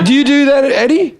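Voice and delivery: high incredulous laughing question. Real, not acted. Asking Eddie in laughing disbelief.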